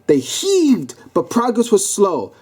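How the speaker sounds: ordinary delivery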